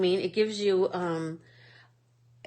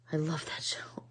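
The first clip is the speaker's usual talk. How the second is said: hushed, secret-sharing whisper; confiding whisper; 8 dB quieter than usual. Real, not acted. Telling Jan she loves the show, whispered. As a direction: lean in and whisper it to a friend.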